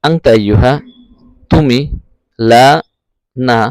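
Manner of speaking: normal talking voice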